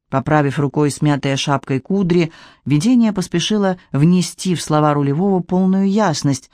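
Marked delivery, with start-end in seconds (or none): none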